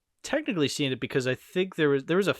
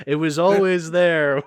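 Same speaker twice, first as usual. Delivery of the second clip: laughing; 6 semitones higher, 9 dB louder than usual. Real, not acted. Laughing through joke.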